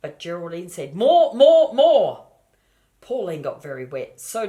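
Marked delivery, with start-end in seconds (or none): 1.0-2.3 loud, high, insistent more; greedy piglet yelling more; demanding shouts. Acted.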